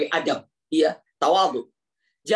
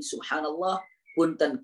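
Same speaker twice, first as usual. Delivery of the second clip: usual speaking voice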